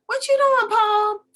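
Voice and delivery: high, loud holler from the street; mimicked nosy neighbor call. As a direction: sing out to him across the yard.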